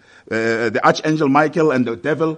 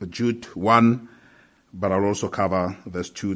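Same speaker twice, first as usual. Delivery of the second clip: ordinary talk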